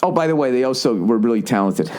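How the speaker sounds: usual speaking voice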